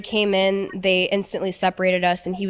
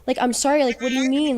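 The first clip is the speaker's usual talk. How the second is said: higher, whiny imitation of his sorry; sneering impression of his pleading; mocking the boyfriend's fake apology; mock apologetic boyfriend; 6 semitones higher than usual. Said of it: "and now you're saying".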